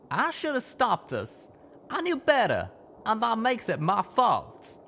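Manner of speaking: high, slow breaking voice; crying. A narrator's reading. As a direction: blame himself through tears. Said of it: "My friend was crying now".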